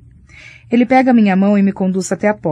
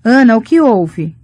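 "Ana, o que houve?" asked slower and firmer, with a falling end; sudden concern, no more small talk; a worried mother turning serious; 3 semitones higher, 7 dB louder than usual. A narrator's reading. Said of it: "Está toda séria agora".

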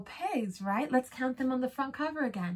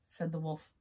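usual speaking voice

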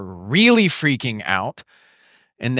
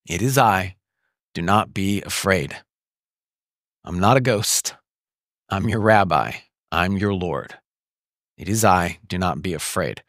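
low, gentle and steady; calm reassurance; 8 semitones lower than usual. Acted. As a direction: soothe them, unhurried. Jesus speaks peace to them.

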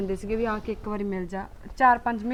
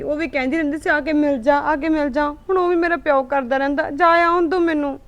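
loud upset complaint; 3 semitones higher, 7 dB louder than usual. Acted. Wife complains about her parents.

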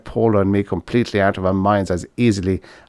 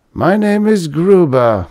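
weary man; 9 semitones higher, 5 dB louder, slower than usual. A narrator's reading.

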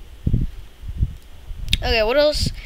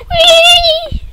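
very high, loud gleeful squeal; excited squeal; 15 dB louder than usual. Real, not acted. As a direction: let out a thrilled whee. The game flings him.

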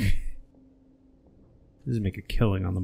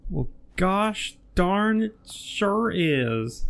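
high, slow hokey drawl; folksy drawl; 9 semitones higher, much slower than usual. Pretend. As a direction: drawl it like a cheerful old farmer. Folksy joking reply.

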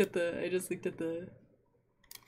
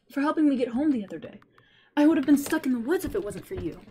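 put-on higher, louder, quick little boy; eager boy voice; 6 semitones higher, 4 dB louder than usual. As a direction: chirp it out like an excited child. Scarecrow boy voice.

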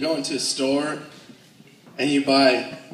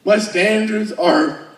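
laughing confession; 6 semitones higher, 4 dB louder, slower than usual. Retold or imitated. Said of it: "I'm like".

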